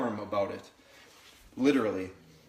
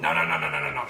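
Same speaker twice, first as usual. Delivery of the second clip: much lower, steady repeated no; low firm no; 10 semitones lower, faster than usual. Real, not acted. Trainer stopping the puppy chewing the mat, low firm no. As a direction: cut her off low and firm.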